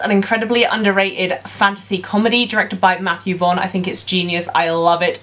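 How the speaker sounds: normal talking voice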